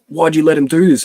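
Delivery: high, loud retold challenge; mock accusing. Onlookers blaming the friend for the mate's state, mock accusing. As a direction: imitate people blaming you.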